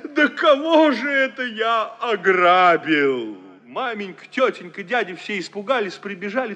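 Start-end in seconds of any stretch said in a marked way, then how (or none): 0.0-3.4 slow, drawn-out wail, half laughing; howling lament. Acted. Hero howls in horror at having robbed someone. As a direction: wail it out in despair. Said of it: "а завыл".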